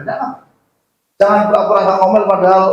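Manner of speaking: ordinary delivery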